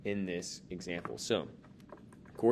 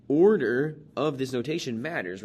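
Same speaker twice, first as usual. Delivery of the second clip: "order of this notation matters" raised and louder; emphatic; 5 semitones higher, 10 dB louder than usual. Shown as a demonstration. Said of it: "teacher emphasizing point".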